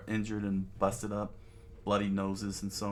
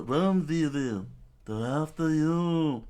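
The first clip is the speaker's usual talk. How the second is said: high, loud, drawn-out yell; screamed warning; 7 semitones higher, 6 dB louder, slower than usual. A narrator's reading. Boy warning his friend to run from bandits, screaming. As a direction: holler it slowly across the sand. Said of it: "Koza screamed out".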